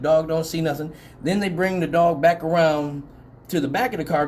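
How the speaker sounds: ordinary talk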